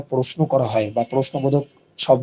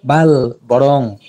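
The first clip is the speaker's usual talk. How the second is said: বাল, বরং stretched slow and louder; slow, loud বাল then বরং; emphatic slow; 5 dB louder, much slower than usual. Shown as a demonstration. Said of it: "আমি শিক্ষক না".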